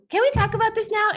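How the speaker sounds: pestering partner. Retold or imitated. Imitating Bella again.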